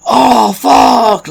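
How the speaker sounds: high, loud cry of sudden pain; pained yell. Retold or imitated. Man on the supermarket floor in pain, high pained yell. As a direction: yelp it like something just ripped. Retold pain in grocery store.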